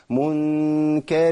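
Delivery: منكر with a thick, heavy nasal hum; heavy ghunna. Shown as a demonstration. Teacher showing how moving toward the fa thickens the ghunna. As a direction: darken the ghunna on منكر too much. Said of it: "هفخم غنى".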